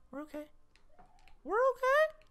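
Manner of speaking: very high falsetto; mock-nervous squeak; squeaky self-reassurance. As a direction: reassure yourself in a tiny high voice. Reassuring self in tense play.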